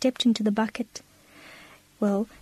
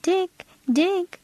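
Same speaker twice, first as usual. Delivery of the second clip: put-on high-pitched little girl calling her pet; eager child's call without shouting; calling cry; 8 semitones higher than usual. A narrator's reading. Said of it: "cried Minnie".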